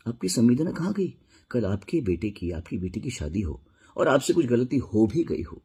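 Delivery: usual speaking voice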